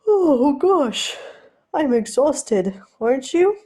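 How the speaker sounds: high, loud, hammed-up yawning voice; fake yawn. Steed faking tiredness to escape Ed, hammed-up yawn. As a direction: fake being exhausted, badly. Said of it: "He fakes a yawn".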